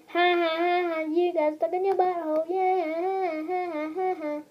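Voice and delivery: teasing laugh-song; mocking sing-song. Junior taunting Daddy about the butthole, sing-song laugh.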